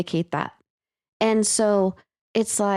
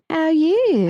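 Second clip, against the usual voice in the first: high excited how you; excited greeting; 8 semitones higher, 4 dB louder than usual. Pretend. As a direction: squeal the greeting.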